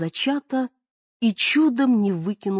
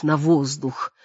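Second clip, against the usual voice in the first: ordinary delivery, nothing marked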